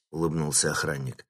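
plain reading voice

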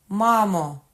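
loud, high, drawn-out call. A narrator's reading. Young man answers mother from courtyard, calling out. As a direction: hail your mother from afar. Son calls back to mother.

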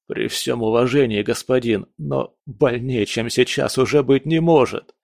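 ordinary delivery

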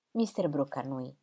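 plain reading voice

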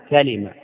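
كلمة as ka-li-mah, three syllables; كلمة: open ka, then li; kasra on the lam. Shown as a demonstration. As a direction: say كلمة with a short i after the lam. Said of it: "بفتح الكاف وكسر اللام".

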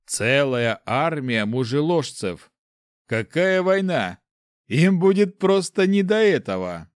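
slow, emphatic pitch to a comrade; rousing persuasion. A narrator's reading. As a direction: sell the vision with mounting zeal. Character urging on a plan.

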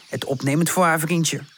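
ordinary delivery, nothing marked

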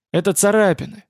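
plain reading voice

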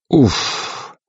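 breathy sigh of relief; exhaled "oof". A narrator's reading. Navigator once the captains fall silent, exhaling. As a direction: blow out a long puff of air. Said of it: "выдохнул Кошкин".

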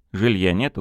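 ordinary delivery, nothing marked

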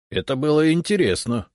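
smirking. A narrator's reading. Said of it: "усмехнулась левая голова".

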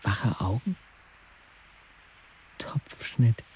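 softly whispered aside; quiet whispered detail. Acted. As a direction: breathe it out under your breath. Narrator's whispered observation.

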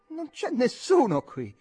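high, loud and slow contemptuous yell; scornful shout. A narrator's reading. Jim shouting into the dark storeroom, scornful. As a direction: bellow it with scorn. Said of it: "urlò Jim con voce sprezzante".

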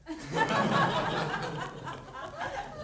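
a breathy, voiceless burst of laughter; airy high laugh. Retold or imitated. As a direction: laugh mostly on air, high up. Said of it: "Kortner lachend".

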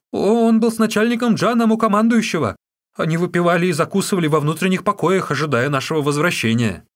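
high, loud, frantic confession; terrified captive. A narrator's reading. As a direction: spill everything in fear for your life.

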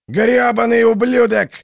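high, loud furious roar; furious yell. A narrator's reading. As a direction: scream it at the statue. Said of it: "орал он". (яростный ор)

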